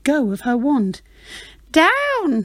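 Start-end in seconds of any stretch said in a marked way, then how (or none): 1.7-2.4 high witch call; the witch calling her broom to land; witch crying out. Acted. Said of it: "cried the witch".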